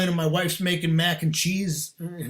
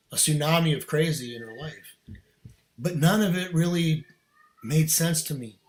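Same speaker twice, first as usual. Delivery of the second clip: normal talking voice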